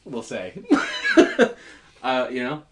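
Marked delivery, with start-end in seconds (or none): 0.7-1.7 high squealing laugh. Real, not acted.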